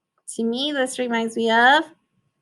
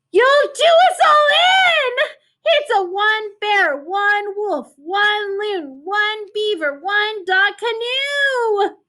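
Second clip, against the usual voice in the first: put-on a little girl panicking at her crew; girl's cry of warning; 7 semitones higher than usual. Girl warns the animals the canoe is too small, high-pitched. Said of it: "girl scolds her crew".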